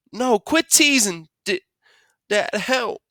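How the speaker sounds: high, slow muffled whine; distressed pleading. Acted. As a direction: plead through stuck lips. Stuck Byron pleads with Dad.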